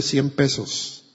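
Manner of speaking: low, slow, grudging mumble; annoyed tired father's grudging answer; barely opening his mouth. A narrator's reading. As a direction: drop it out half-unwilling. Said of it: "el padre apenas abrió la boca para decirle".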